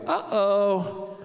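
sudden realization of trouble; dawning alarm. Acted. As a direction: sound caught out by your own words. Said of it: "And then you're like".

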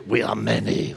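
low, growling demon voice; demoniac voice. Acted. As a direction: growl it low like one possessed. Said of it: "he starts screaming".

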